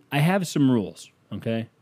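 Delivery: ordinary talk, nothing marked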